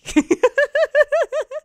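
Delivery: loud high-pitched burst of laughter; high shrieking laugh. Real, not acted. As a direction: shriek with laughter at your own joke. Comic cracks himself up.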